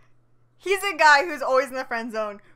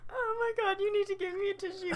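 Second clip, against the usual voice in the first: crying with laughter; 7 semitones higher, 8 dB quieter than usual. Real, not acted. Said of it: "I'm gonna cry".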